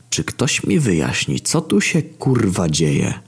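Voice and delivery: high, swooping exasperated question; bewildered outburst. Acted. Demon stunned as two armies clash, bewildered. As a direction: ask it in rising disbelief, falling at the end. Narrator asks amid sudden battle.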